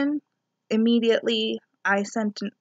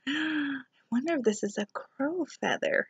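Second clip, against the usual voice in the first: hushed wondering thought; 4 semitones higher, 9 dB quieter than usual. Retold or imitated. Retold thought on finding feather.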